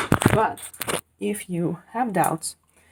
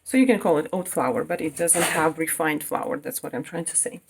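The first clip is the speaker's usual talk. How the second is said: ordinary talk, nothing marked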